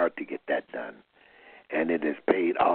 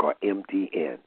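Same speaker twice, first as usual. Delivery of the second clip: ordinary talk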